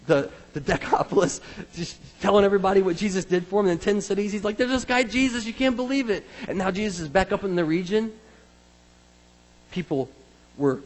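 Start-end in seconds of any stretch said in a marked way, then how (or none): 4.6-6.2 high-pitched, amazed report; excited testimony. Acted. Healed man telling ten cities about Jesus, excited. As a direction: gush the news to everybody.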